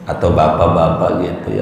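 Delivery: normal talking voice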